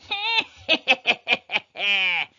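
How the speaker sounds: high, loud mocking laugh of a rabbit; shrill rabbit laugh. A narrator's reading. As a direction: cackle up from the burrow. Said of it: "the shrill laugh of a rabbit".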